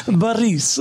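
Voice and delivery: Baris tried with a rolled r; a careful try at Baris with a trill; attempted rolled r. Shown as a demonstration. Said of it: "That's my best shot at that".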